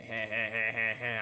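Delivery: a flat, fake, joyless chuckle; forced fake laugh. Grudging fake laugh forced by Sandy's threat. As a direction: force a laugh out because you're told to. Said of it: "I'll laugh then".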